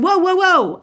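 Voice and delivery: high-pitched interrupting protest; re-enacted objection, voice jumping up; retold protest. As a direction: cut them off, mock-alarmed. Retold quarrel, cutting him off.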